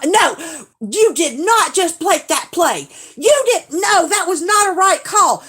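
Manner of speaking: high, loud protest at a bad call; mom yelling at the TV. Mom protesting a bad call on TV, high and loud. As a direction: imitate mom railing at the referee. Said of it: "but then she's like".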